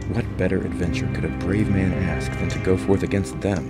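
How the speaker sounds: usual speaking voice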